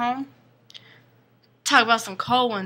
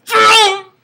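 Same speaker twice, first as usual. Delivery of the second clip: loud, high vroom; imitated car roar; 12 semitones higher, 13 dB louder than usual. Storyteller mimics the car that came out of nowhere, loud engine roar. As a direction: mimic a car bursting past at full volume.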